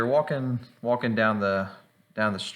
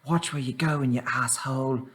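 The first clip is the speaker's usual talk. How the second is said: lightly acted cranky threat, pitched up; mock angry halfling; 9 semitones higher, 3 dB louder, slower than usual. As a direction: play a riled halfling threatening a stranger. Bumped halfling snaps at player.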